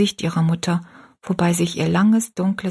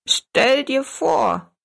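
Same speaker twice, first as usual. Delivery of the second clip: drawn-out, higher and bright with joy; cheerful exclamation; 7 semitones higher, much slower than usual. A narrator's reading. Little old woman cheering to Rosalie, slow happy cry. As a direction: delight bursting out slowly. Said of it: "rief die kleine Frau fröhlich".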